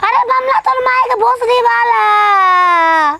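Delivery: high, drawn-out tearful wail; wailing yell. Acted. Wife scolding her husband, wailing yell. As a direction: howl it out in protest, stretching the ends. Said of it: "चिल्ला तड़ी तू".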